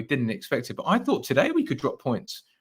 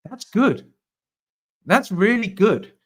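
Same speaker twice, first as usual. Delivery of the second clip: excited; 9 semitones higher, 5 dB louder, slower than usual. Real, not acted. Delighted at good results.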